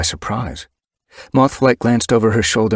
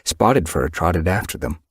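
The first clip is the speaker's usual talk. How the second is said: plain reading voice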